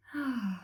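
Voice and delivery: short sigh falling away; audible sigh. Shown as a demonstration. Teacher showing students a breath, audible sigh. Leading cleansing breaths for class.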